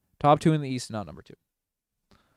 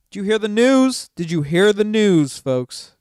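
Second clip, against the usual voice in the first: high and loud, showman's shout; loud hype call; 7 semitones higher, 8 dB louder than usual. Acted. Streamer announcing to viewers, loud showman call. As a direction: sell it to the crowd.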